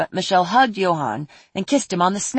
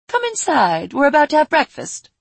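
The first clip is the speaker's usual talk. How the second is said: welcoming queen; 8 semitones higher, 5 dB louder than usual. Acted. Queen inviting guests.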